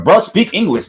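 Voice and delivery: bro, speak English, with a high exasperated push. Retold or imitated. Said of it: "You be like".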